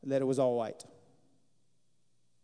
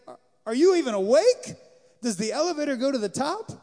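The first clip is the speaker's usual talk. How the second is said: exasperated daddy; 8 semitones higher, 8 dB louder than usual. Retold or imitated. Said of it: "He's having a little daddy moment".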